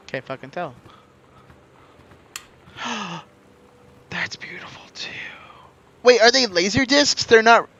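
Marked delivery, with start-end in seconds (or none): 2.7-3.2 short gasp of wonder; quick amazed intake of breath; awed gasp. Real, not acted. Amazed by another find. 4.1-5.1 hushed, whispered wonder; real breath-only whisper of awe; awed whisper. Real, not acted. Awed by another find.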